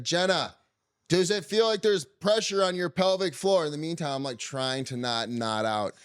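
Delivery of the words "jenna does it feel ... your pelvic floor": high, slowed, projected motherly voice; mom on speakerphone. Retold or imitated. Mom questioning laboring daughter-in-law over speakerphone, projecting. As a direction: imitate a mother calling through a speakerphone. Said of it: "my mom's on speakerphone".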